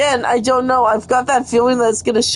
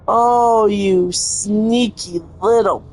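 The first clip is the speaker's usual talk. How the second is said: ordinary talk, nothing marked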